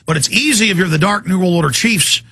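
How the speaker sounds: ordinary talk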